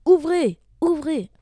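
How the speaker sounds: high, very loud wolf shouts; two forceful, falling yells; shouted demand. Acted. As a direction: bellow it as you pound on the door. Wolf punches the door demanding entry.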